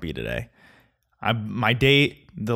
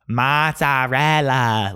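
put-on very slow, overblown Italian accent, MAA-TSAA-REL-LAA; douchey Italian accent; 3 semitones higher, 3 dB louder, much slower than usual. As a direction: ham up the Italian, stretching every vowel. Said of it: "does that sound like the way people say it".

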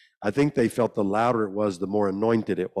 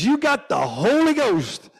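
much higher, slow lady's announcement; triumphant church lady; 5 dB louder, slower than usual. Retold or imitated. Church lady telling the boy the news, much higher and slow. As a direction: proclaim it like a beaming church lady. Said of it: "She says".

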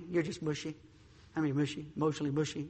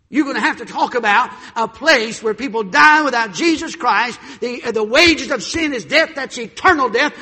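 shouted, much higher and louder preaching; full-force preaching; 11 semitones higher, 17 dB louder than usual. Acted. Preacher shouting about hell and salvation. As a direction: thunder it out from the pulpit.

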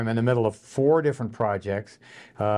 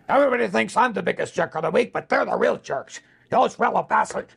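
higher gravelly Boston whiskey voice; Johnny Most; 10 semitones higher than usual. Retold or imitated. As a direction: rant it like a raspy Boston broadcaster. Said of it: "Okay, do Johnny Most".

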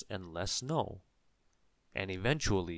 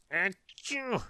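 big sneeze; 12 semitones higher than usual. A narrator's reading. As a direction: sneeze it out hard. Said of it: "replied Mister Sneeze, who had started sneezing again".

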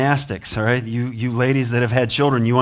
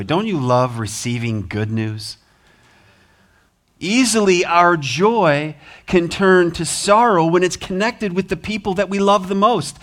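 ordinary talk, nothing marked

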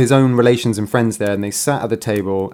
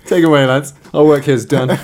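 mock lawman; 3 semitones higher, 4 dB louder than usual. Echoing the lawman joke.

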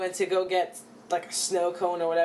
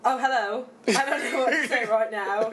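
giggly put-on English accent. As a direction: improvise in a playful English accent. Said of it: "but do an English accent".

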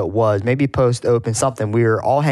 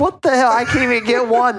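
exasperated mock outburst pitched way up; higher and incredulous, a put-on disbelieving whine; frustrated gym guy; 12 semitones higher than usual. Gym member watching a trucker's muscle up, exasperated and high. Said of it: "he's like".

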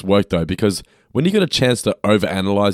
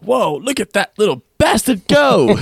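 cartoon voice; 11 semitones higher, 5 dB louder, slower than usual. Voicing South Park character.

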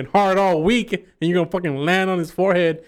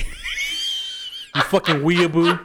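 slow, higher teasing drawl through laughter; laughing mock insult; 6 semitones higher, much slower than usual. Real, not acted. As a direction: stretch out the insult while cracking up.

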